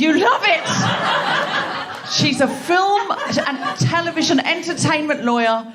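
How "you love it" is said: high, drawn-out cry of disbelief; incredulous exclamation. Real, not acted. Host echoing lawyer who loves the job, incredulous. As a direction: stretch it out, pitched way up in amazement.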